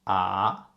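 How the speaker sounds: "ah" gliding steadily upward; rising tone. Shown as a demonstration. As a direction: lift "ah" like a surprised question. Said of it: "it goes up all the time".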